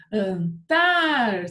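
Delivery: "Antártida" slow, TÁR loud and long; stress on TÁR. Shown as a demonstration. Teacher asking for the tonic syllable, stressed on TÁR. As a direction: stretch and punch the TÁR in "Antártida". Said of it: "Qual que é a sílaba tônica?".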